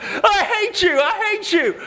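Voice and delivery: high, loud mock wail of a child; crying little boy. Little boy screaming at the woodworker at Lowe's, mock crying. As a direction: wail it like a tearful little kid. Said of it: "the little boys are crying".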